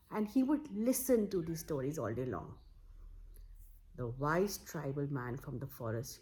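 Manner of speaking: plain reading voice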